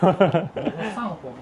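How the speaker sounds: 上活 for 生活, said slowly with a laugh; laughing, slow mimic of 上活; imitating a mispronunciation. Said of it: "你说的是生活".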